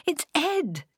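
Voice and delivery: very high, excited exclamation; laughed, delighted. A narrator's reading. Mimi recognises the man with the surfboard, high delighted cry. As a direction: squeal it with happy surprise. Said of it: "she laughed".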